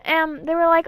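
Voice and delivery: ordinary delivery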